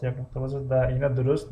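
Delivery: usual speaking voice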